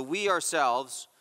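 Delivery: "we ourselves" slowed, both words weighted; emphatic stress. Shown as a demonstration. Preacher explaining the doubled first person, slow emphatic stress. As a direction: draw out "we ourselves" to mark the emphasis. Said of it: "is emphasized here".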